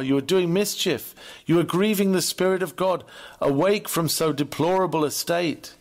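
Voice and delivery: usual speaking voice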